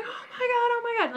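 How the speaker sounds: high, shrieking mock panic; retold panicked scream. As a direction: relive the fright in a squealing high voice. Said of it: "and I'm screaming".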